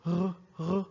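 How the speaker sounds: French R gargled in the throat, said twice; teacher isolates the French R for students; guttural R. Shown as a demonstration. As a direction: make the "R" rasp at the back of the throat. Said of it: "It's like in the throat".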